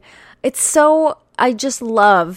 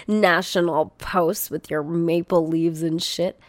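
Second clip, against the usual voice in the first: ordinary talk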